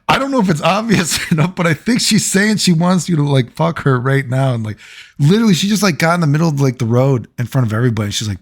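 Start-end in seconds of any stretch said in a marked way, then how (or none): 0.1-4.5 higher put-on voice for the guy's line; mock guy voice, pitched up; mimicking a guy. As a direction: imitate the buddy egging him on, voice raised. Retelling a guy's remark.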